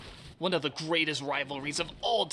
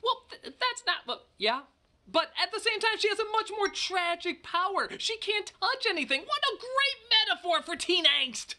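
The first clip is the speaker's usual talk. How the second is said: high, rattled excuse; flustered defense. Real, not acted. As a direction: argue back in a high flustered voice.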